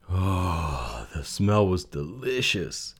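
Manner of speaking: slow, relishing sigh into the words; drawn-out delighted narration; savouring. A narrator's reading. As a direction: linger blissfully over the smell. Savouring the smell of slops.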